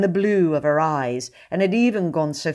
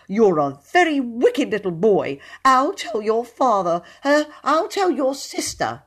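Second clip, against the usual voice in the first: high, louder prissy scolding; righteous wrath; 7 semitones higher, 4 dB louder than usual. Acted. Poet threatening William in high, prissy wrath. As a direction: scold him with prim outrage. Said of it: "in righteous wrath".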